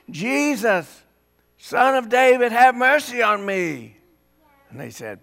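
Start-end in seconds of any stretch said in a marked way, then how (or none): 0.1-4.0 higher and louder cry of Jesus, Son of David; loud hollering. Retold or imitated. Blind man hollering louder for Jesus to hear, loud cry. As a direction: holler it out over the crowd. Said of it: "yeah, louder than that".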